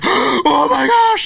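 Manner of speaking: high, loud, slow gasped squeal; gasping squeal. Retold or imitated. Kids learning the author watched, high gasping squeal. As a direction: squeal it out in a stunned gasp.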